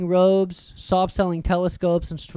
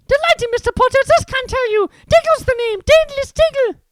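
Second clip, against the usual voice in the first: very high, loud, breathless delight; excited little wizard; 9 dB louder than usual. Acted. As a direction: squeak with excitement. Excitable wizard voiced in story.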